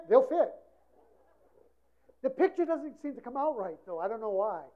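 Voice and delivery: ordinary talk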